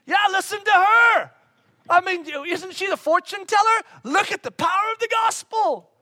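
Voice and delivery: high, excited shouts; delighted high yell. Pretend. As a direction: cheer her on, thrilled. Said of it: "I'd go".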